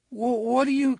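high, stammering and scared; trembling. Acted. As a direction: stammer it out in fear. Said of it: "lips wet and trembling".

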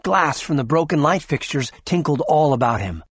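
usual speaking voice

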